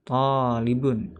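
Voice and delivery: tolibun drawn out slowly, syllable by syllable; slow model word. Shown as a demonstration. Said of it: "tinggal berubah jadi anta".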